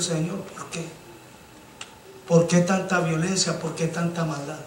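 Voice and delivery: quiet lament. Retold or imitated. People ask the Lord quietly why there is so much evil. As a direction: murmur the question in sorrow.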